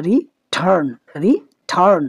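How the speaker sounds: Return split in two, pressure on turn; stress on second syllable. Shown as a demonstration. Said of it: "টি এর মধ্যে উচ্চারণটা কিন্তু অনেকটা stress হচ্ছে".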